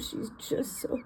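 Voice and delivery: slow breathy whisper over a sick chick; whispered tenderness. Real, not acted. Checking on baby chick, slow tender whisper.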